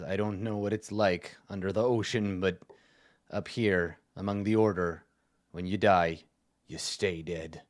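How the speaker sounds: low, quiet grim warning; a scowling soldier, dropping his voice to a grim hush; scowling dour guard. Acted. Guard to the adventurers, scowling and low. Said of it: "he scowls at you and he says".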